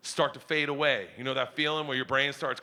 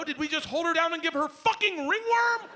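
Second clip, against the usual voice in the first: normal talking voice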